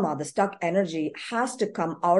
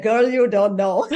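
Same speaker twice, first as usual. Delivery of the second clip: sassy inner voice; 3 semitones higher, 8 dB louder than usual. Retold or imitated. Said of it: "I'm like".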